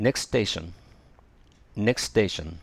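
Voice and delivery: "Next station" said as neks-teishon, one smooth link; dropped T. Shown as a demonstration. Teacher leading repeat-after-me practice, T of Next left silent. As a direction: model "Next station" without the T of Next. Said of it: "前のTを発音しなければ".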